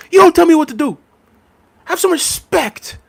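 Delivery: high, hammed-up angry imitation of Ty; mock Ty argument. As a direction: friend imitating Ty's stubborn arguing, high and mocking. Imitating Ty arguing with Claude.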